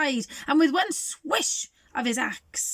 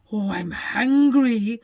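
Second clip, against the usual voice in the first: louder, slow greedy wolf voice; hungry wolf; 7 dB louder, slower than usual. Acted. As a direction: moan it like a starving wolf. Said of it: "thought the big bad wolf".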